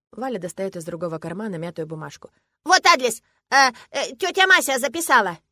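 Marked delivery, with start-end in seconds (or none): 2.6-5.4 put-on high, loud childish voice, stumbling briefly; eager little girl. A narrator's reading. Girl handing father the address, eager. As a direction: show it off like an excited child.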